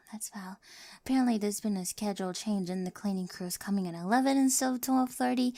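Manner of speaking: ordinary delivery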